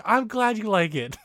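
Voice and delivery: high-pitched, sweet customer-service voice; put-on perky politeness; chirpy maid imitation. Imitates cheerful maid replying.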